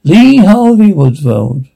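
announcer reveal. Acted. Announcer reveals name dramatically.

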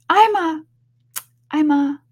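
high, loud halting attempts; Alice, flustered. Alice struggling to say what she is, flustered. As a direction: start and break off in a fluster. Alice falters under accusation.

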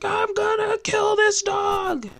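high, drawn-out exasperated wail; mock fit of rage. Owner finds the dog did it again, mock rage. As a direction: act out losing it, stretched and high-pitched. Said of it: "Fits of rage".

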